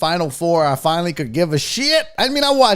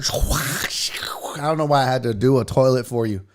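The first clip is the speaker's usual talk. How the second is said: under his breath, voiceless; a breathless whispered aside; 7 semitones lower than usual. Real, not acted. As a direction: whisper it to yourself, half embarrassed. Muttered aside to himself.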